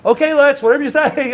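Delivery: high, loud, incredulous going-along; humoring a friend. Retold or imitated. Friend answering Lex's stubborn optimism, humoring him. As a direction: give in loudly, not believing a word.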